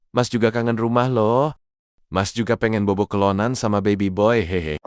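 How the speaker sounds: ordinary delivery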